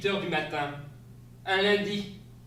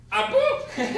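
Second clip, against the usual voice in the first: high, loud startled "Ah bon?" with a laugh; surprised laugh; 9 dB louder than usual. Acted. Wife reacting to husband's costume, high startled laugh. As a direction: squeak it out then laugh in disbelief.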